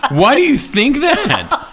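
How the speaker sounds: high, slow, laughing question; real laughing, high and slow; laughing disbelief. Real, not acted.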